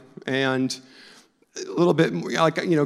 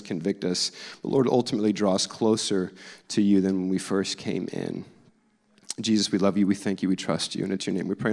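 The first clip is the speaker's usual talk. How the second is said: prayer; 5 semitones lower than usual. Acted. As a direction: pray gently over the congregation. Pastor leads opening prayer.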